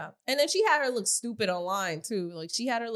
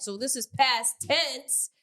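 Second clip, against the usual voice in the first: ordinary talk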